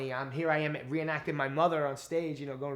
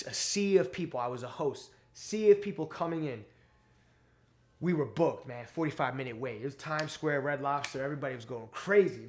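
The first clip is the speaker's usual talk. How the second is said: usual speaking voice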